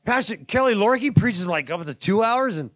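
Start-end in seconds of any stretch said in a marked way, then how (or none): none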